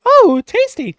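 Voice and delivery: put-on high, loud delighted old lady; a carnival-goer old lady thrilled by the chili dog in her hair. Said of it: "She's like".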